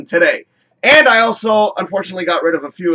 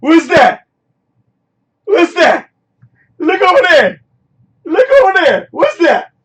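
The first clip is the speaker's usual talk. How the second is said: high, loud sing-song calling out; playful teasing call; 11 semitones higher, 6 dB louder than usual. Acted. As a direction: tease the audience toward the big reveal. Teasing reveal of the screen.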